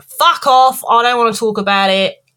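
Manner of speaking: fed-up, forceful acted retort; acted snapping. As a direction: snap and shut the questions down. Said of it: "all of a sudden she snaps".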